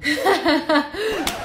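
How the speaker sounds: awkward cover-up laugh; forced polite chuckle; hopeful fake laugh. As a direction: laugh along without having heard. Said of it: "I'm just gonna laugh and hope".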